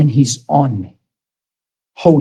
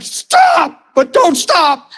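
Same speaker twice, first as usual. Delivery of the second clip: much higher cry of stop, then a tearful laugh; much slower than usual. Retold or imitated. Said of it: "And I'm just like".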